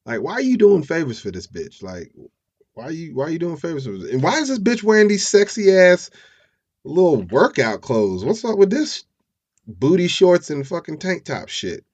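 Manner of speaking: high, loud incredulous complaint; mock indignant questioning. Fiancee questioning John about the favors, acted indignation. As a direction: play up a jealous rant, pitch climbing.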